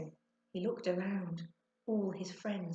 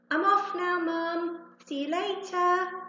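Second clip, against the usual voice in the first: called out high and loud; louder voice; 12 semitones higher, 8 dB louder, slower than usual. A narrator's reading. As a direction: sing out a goodbye to the whole house. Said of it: "in a louder voice he said".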